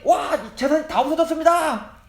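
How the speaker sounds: high-pitched cry of bad news; alarmed report. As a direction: play a messenger bursting in with disaster. Servant bursts in with disaster news. (다급하게 높은 목소리로 알리듯)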